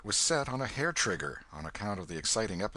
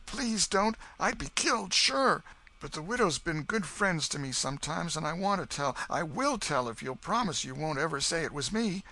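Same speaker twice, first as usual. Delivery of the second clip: put-on pleading boy; 8 semitones higher than usual. Huck begging the Welshman to keep his secret. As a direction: plead with them not to tell.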